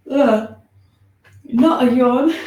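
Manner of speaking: real laugh breaking into the words. Real, not acted. Teacher laughing at her own yawning with the class. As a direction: giggle through it.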